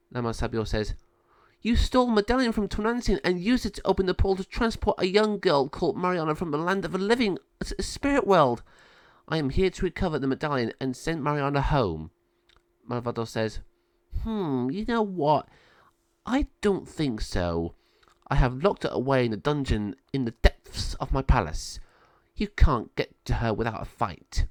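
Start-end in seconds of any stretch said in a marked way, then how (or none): 1.6-12.1 lightly voiced wise sister. Sabio explains to Malvado. 14.2-24.5 gloat, then drop to a threat; villain refusing. Acted.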